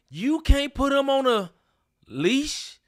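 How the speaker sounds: high, incredulous rant; can't believe what they do; exasperated rant. Real, not acted. Ranting at dog owners.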